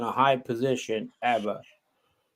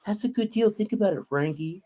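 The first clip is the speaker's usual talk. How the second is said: higher, mocking lawyer voice, coaxing; mocking rat lawyers; 7 semitones higher, 3 dB quieter than usual. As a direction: sweet-talk him like a sleazy lawyer. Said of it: "They go".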